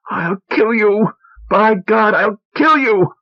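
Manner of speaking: high, slow, crying scream. A narrator's reading. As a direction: scream it through tears, out of control. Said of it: "she screamed".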